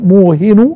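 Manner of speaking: موهن as muuhinu; single damma. Shown as a demonstration. Teacher showing one vowel mark, موهن ending in -u. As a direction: end موهن on short u, no nunation. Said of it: "بضمة واحدة على".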